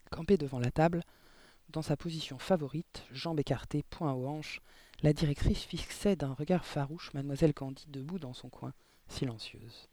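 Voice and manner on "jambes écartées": plain reading voice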